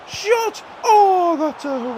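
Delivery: very high yelp at the shot; excited shout. Real, not acted. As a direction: cry out as the ball flies wide. Near miss on the counter.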